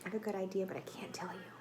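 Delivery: soft teasing whisper; a hushed secret whispered to the class. Acted. Teases children with a secret.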